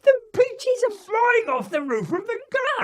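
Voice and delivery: put-on very high, loud excited boy shouting; child calling out. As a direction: shout in giggly alarm at the peaches. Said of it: "Joe is calling out".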